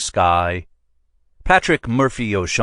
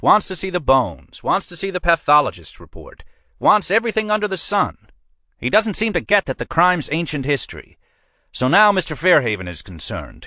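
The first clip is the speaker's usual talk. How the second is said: higher-pitched, pushy precinct captain; a captain pressing his man, voiced higher than the narrator; police captain; 9 semitones higher than usual. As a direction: lean on your sergeant like a boss who wants results. Captain briefs sergeant in story.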